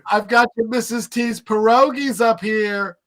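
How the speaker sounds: proud mother announcing snacks; sweet mom voice. Retold or imitated. Mom voice again.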